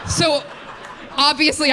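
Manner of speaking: ordinary talk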